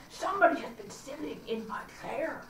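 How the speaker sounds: lightly voiced big bear; a hint of a gruff papa bear; great huge bear. A narrator's reading. Narrator lightly voicing the great bear about his chair. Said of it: "in his great, rough, gruff voice".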